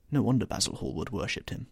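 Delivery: plain reading voice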